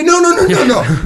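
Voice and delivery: loud, high rapid string of no's; mock protest. Husband protesting when his wife agrees it's his fault, loud. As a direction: protest like a husband who didn't mean it.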